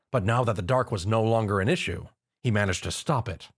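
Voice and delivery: plain reading voice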